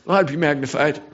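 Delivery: ordinary delivery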